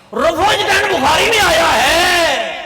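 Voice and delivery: high, loud, drawn-out preaching cry; shouted proclamation. Real, not acted. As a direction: proclaim it to the hall, stretching the words. (گرج دار خطیبانہ للکار)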